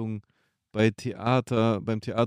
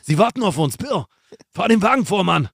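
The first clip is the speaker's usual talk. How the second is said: loud, higher, tough film-hero shouting; gritty action movie; 8 semitones higher, 8 dB louder than usual. Retold or imitated. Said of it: "der Joni macht das ja auch immer".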